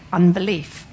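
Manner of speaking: ordinary talk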